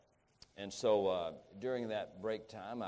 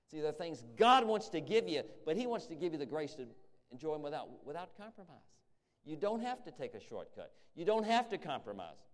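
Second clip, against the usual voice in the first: higher-pitched, insistent sermon delivery; emphatic preaching; 7 semitones higher, 4 dB louder than usual. Real, not acted. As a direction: press the promise home to the congregation. Preaching with force.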